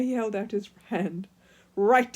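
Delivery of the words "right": grumpy. A narrator's reading. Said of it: "he said grumpily".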